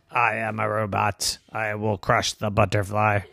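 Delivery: low monotone robot threat. Acted. Robot threatening to crush butterflies, monotone robot voice. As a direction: intone it evenly like a robot.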